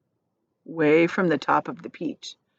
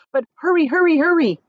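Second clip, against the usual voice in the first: high, louder hurrying; urgent; 9 semitones higher, 6 dB louder than usual. Acted. Centipede urging James to hurry, high and loud. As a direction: hurry him along.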